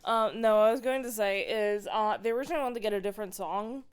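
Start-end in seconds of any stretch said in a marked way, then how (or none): none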